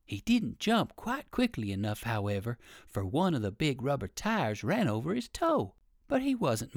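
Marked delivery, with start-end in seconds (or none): none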